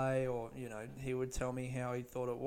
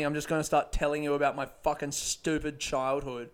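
ordinary talk

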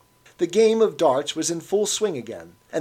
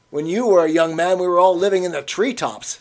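louder teasing jab; mocking barman; 5 semitones higher, 7 dB louder than usual. A narrator's reading. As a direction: play to the crowd at the bar. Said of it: "with a glance at the other customers".

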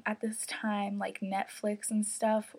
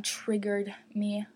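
usual speaking voice

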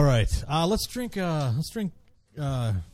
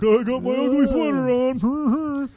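mocking coworker voice; 12 semitones higher, 5 dB louder than usual. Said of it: "they're like".

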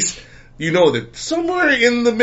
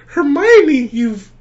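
gushing voice; 11 semitones higher, 3 dB louder, much slower than usual. Retold or imitated. Retold gushing compliment.

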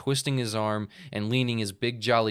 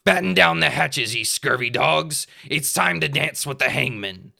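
loud, higher pirate battle cry; pirate shout; 5 semitones higher, 7 dB louder than usual. Acted. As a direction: roar it charging in. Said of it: "he shouted".

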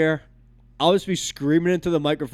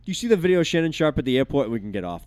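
usual speaking voice

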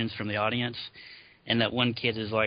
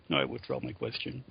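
much lower, softer deflated mumble; meek retreat; 8 semitones lower, 4 dB quieter than usual. Retold or imitated. Kid backing down after Homer's put-down, deflated. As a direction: cave in quietly. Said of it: "And he's like".

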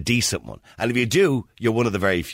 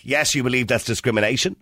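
usual speaking voice

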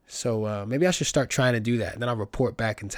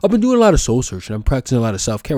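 mock social media voice; 7 dB louder, faster than usual. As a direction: rattle it off like a wellness influencer. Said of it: "people will tell you on social media".

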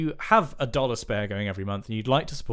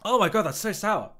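sucking a lemon; 7 semitones higher than usual. Real, not acted. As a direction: mouth puckered from lemon. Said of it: "I was just sucking on a lemon".

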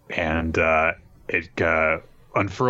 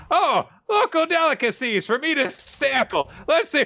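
very high squeaky put-on voice; cartoonish, high-pitched eager little critter; excited creature. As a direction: squeal with hammed-up delight at the food. Voices excited creature tasting food.

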